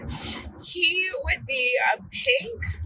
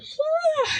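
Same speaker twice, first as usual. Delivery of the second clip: long tired yawn, high; a sleepy driver's yawn; real yawn; 13 semitones higher than usual. Real, not acted. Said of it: "sorry, yawn".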